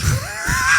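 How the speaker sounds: big, high burst of laughter; loud high laugh. Real, not acted. Laughing at himself after the rally cry, big laugh.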